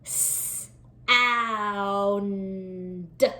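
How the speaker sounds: s, ow, n, d stretched apart; segmenting sounds. Shown as a demonstration. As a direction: say each sound apart, slowly.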